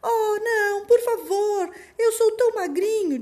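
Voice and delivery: very high frightened little goat; tiny pleading voice. Acted. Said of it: "disse o caçula". (vozinha fina suplicante)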